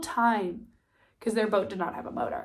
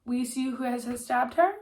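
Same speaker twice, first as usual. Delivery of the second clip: ordinary delivery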